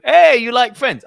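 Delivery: loud, high, drawn-out opener; acted pick-up call. Man hitting on a woman in a bar, acted loud call. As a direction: call it out boldly to get attention.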